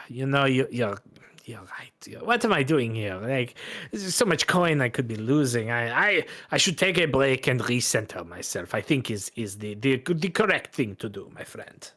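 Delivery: chastened Bretonnian gambler, hushed at moments; French-accented gambler. Acted.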